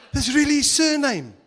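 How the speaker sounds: loud, high burst of laughter through the words; laughing. Real, not acted. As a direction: crack up as you say it.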